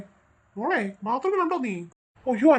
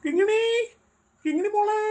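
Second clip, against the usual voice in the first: calling out; 8 semitones higher than usual. Acted. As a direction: call for the lost girl far away. Father calling lost daughter.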